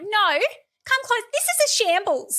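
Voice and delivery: loud, high-pitched outburst at a microphone; imitating Posey Parker. Host imitating activist ranting at a microphone, loud mockery. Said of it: "just being like".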